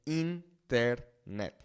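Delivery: internet as een-tehr-net, slowly; Spanish internet, split into een, tehr, net; Spanish pronunciation. Shown as a demonstration. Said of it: "the I in Spanish makes that E sound".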